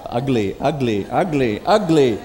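grumbling. Pretend. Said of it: "the whole day you're".

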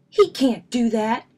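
angry. A narrator's reading. Brother objecting to Fred riding his bike, angry. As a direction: protest it hotly. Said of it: "growled Brother".

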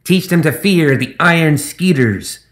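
high, slow, gloating gremlin; gremlin captain. Acted. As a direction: savour the threat like a pompous little pirate. Gremlin Zip's voice.

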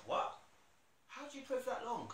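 high, squeaky disbelief; incredulous. Retold or imitated. To friends who pray for hours, high incredulous squeak.

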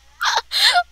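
high loud laughing shriek. Real, not acted. Kid shrieks laughing at the speedy car.

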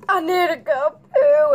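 loud mock-urgent complaint; self-quote, mock wail. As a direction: wail your own words dramatically. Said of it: "All I said was".

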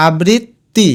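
আবৃত্তি slowly as abrit, ti; split before ti. Shown as a demonstration. Teacher giving the pronunciation, আবৃত্তি split abrit-ti. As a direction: hold the double t in আবৃত্তি. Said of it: "এটার উচ্চারণ".

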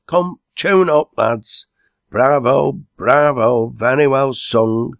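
loud, high calls of bravo; rousing pub cheer; hearty cheering. A narrator's reading. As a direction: cheer the lads on at full voice. Cheering on the singers.

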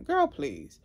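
high, scoffing brush-off; dismissive. Real, not acted. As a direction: wave it away with disbelief. Dismissing his excuse.

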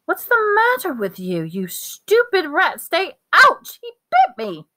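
very high, exasperated yelling; scold, then yelp as if bitten; angry outburst. A narrator's reading. Ron to the rat struggling in his pocket, angry yelping.

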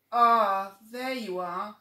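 mummy tiger. Acted. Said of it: "said Mummy Tiger".

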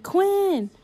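loud, high mock-mad call of his name. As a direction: yell his name like a girlfriend about to scold. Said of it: "in my mad voice".

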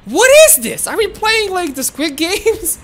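loud, high surprised question; astonished. Real, not acted. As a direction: burst out in disbelief, pitch leaping. Surprised by new game.

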